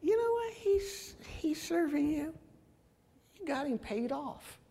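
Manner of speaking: high-pitched Satan making his accusation; Satan's taunting. Acted. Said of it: "Satan's sitting back and saying".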